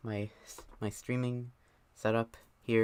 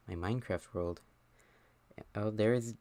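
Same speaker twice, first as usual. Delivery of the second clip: ordinary talk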